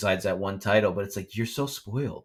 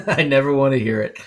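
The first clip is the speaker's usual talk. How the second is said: laughed out, louder and higher; laughing; 5 semitones higher, 8 dB louder than usual. Real, not acted. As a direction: laugh through the words in disbelief. Laughing at fans' complaints.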